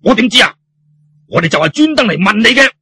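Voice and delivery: puffing, indignant retort; huffy retort. Acted. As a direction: snap back, exasperated. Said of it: "呼呼氣氣噉話".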